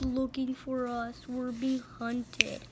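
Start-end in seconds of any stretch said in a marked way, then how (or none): none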